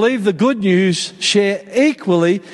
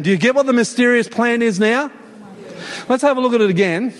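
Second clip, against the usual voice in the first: ordinary delivery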